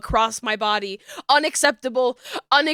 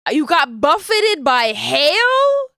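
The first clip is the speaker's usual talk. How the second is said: surprised question; 4 semitones higher, 5 dB louder, slower than usual. Real, not acted. Reacting to viewer's hail story.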